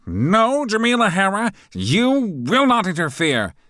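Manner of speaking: very high, loud alarmed shout. A narrator's reading. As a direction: leap up and yell at her. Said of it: "as he shouts".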